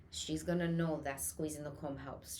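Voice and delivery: ordinary delivery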